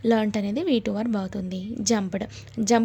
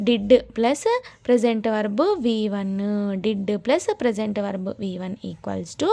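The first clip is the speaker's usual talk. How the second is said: slow formula; much slower than usual. Shown as a demonstration. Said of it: "ఏంటి formula అని అంటే".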